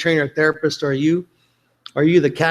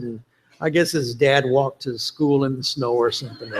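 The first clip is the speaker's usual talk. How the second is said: ordinary talk, nothing marked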